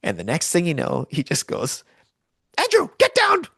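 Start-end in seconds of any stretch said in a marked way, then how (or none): 2.6-3.4 high, loud shouted warning; retold warning yell. Brother yelling at his friend to duck. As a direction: yell it as the ball flies.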